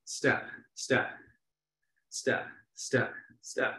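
counting steps under the breath; whispered step count. Shown as a demonstration. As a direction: softly whisper each step in time. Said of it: "You can also go, like, sideways".

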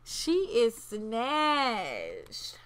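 drawn-out, playful admiration; hyping her up. Pretend. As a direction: stretch the words to hype her look. Hyping up her Sim's look.

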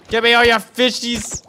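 high, loud mock threat; playful demanding shout. Gamer going after the fish, shouting high. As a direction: yell it like a greedy raider.

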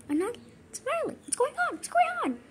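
high-pitched bewildered panic; rising squeal of alarm. Pretend. Alarmed by horror game.

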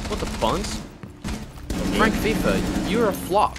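high, loud outraged yelling at a teammate. Real, not acted. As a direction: yell at him in disbelief.

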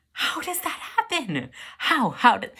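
frantic outburst. Acted. Cook baffled by charred toast, high frantic outburst. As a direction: spiral up into a panicked, high-pitched rant. Acted panic over burnt toast.

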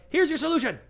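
very high, loud announcement; mock eager presenting. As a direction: play an overeager seller presenting the answer. Acts out blunt pitch to client.

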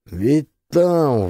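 plain reading voice

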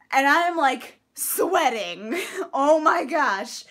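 loud, breathless laughing fluster. Real, not acted. Vlogger embarrassed at getting excited about lists, flustered laughing. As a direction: laugh at yourself for getting so worked up. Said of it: "I need to calm down".

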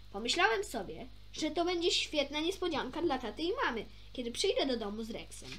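ordinary delivery, nothing marked

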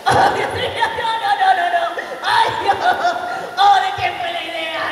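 high, laughing, sing-song cartoon boy; Eric voice, delighted. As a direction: gush with glee over the prank. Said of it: "él empieza". (Eric encantado, voz aguda y cantarina)

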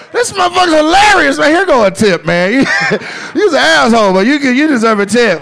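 loud, high, laughing praise; retold excited outburst; amused delight. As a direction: exclaim it loudly, tickled. Acted reaction, tipping the dealer.

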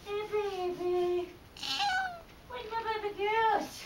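soft, slow cooing to a pet. Real, not acted. Greeting pets, baby talk.

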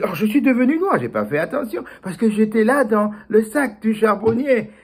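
oh, it dawns on him; surprised realization. Acted.

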